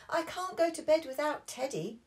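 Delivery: high whiny protest; high childish protest; protesting Peppa. Acted. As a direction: insist you are not sleepy. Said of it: "said Peppa".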